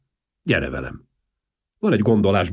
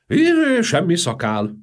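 magas, hangos mentegetőzés; védekező meglepetés; 11 semitones higher, 5 dB louder, slower than usual. A narrator's reading. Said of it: "Próbált Valmier védekezni". (magasan, hangosan tiltakozva)

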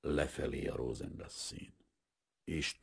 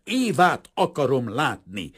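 slow, high, loud demand; roar; 9 semitones higher, 11 dB louder than usual. A narrator's reading. Painter grabbing the father by his coat, roaring. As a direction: shout it word by word, insisting. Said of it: "ordított föl Kazimír".

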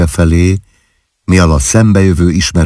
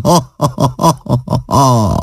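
high laughing ha ha; 8 semitones higher, slower than usual. Acted. Wife laughing off her husband's alarm, high peals of laughter. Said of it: "úgy kaszagott".

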